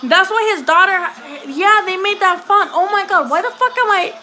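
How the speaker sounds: high, startled outburst with a laugh in it; shocked realization. Real, not acted. Streamer suddenly remembering the boxer's death, shocked. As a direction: blurt it out high as it dawns on you.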